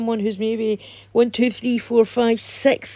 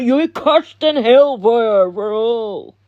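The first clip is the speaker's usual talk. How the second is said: slow, drawn-out mock doom voice; hammed-up hellfire threat; imagined prophet's curse; 4 dB louder, slower than usual. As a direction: put on an ominous curse, stretching the words. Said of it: "You know how they talk".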